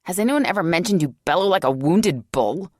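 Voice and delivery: loud, indignant woman's challenge; demanding. A narrator's reading. Becky loudly scolding Boss for bellowing, indignant. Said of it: "Becky demanded".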